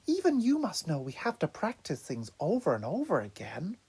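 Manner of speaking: whispering. Acted. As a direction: whisper it gently. Donatello gently reasoning, whispered.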